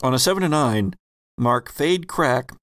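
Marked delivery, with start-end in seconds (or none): none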